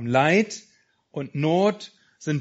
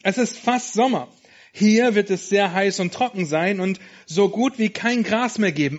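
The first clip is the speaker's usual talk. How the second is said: friendly lamb Sammy; 6 semitones higher, 4 dB louder than usual. Acted.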